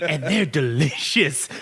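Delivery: slow, savoring delivery; a hint of a smug food ad; R-Truth impression. Friend imitating R-Truth praising chips, slowly. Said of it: "And he's like".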